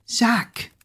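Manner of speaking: high-pitched yell without volume; acted, high-pitched cry; alarmed yell. A narrator's reading. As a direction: call the name in alarm. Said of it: "yelled Kira".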